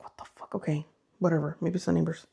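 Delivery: hushed, hurried whisper, half reassuring herself; nervous whisper. Retold or imitated. Frightened, talking herself down at night.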